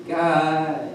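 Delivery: weak, whimpering call for help; pathetic whimper. Retold or imitated. Stuck kid calls after his leaving friends, pathetic whimper. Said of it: "like this really pathetic, like, like a whimpering dog".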